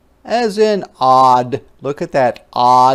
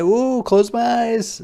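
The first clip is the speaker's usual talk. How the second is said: excited; 9 semitones higher than usual. Acted. Swinging on swing set.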